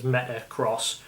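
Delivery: "Metacross", ending in the word cross; official pronunciation. Shown as a demonstration. Host settles the name for viewers, official pronunciation of "Metacross". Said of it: "The official pronunciation is".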